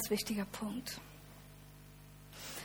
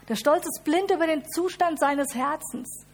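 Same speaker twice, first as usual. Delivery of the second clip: pride voiced loud; 5 semitones higher, 6 dB louder than usual. Pride contrasted loud with humility.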